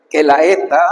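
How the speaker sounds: ordinary talk, nothing marked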